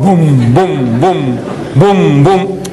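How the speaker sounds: low booming stomps; mock giant footsteps. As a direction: imitate heavy giant steps. King Kong footsteps of God.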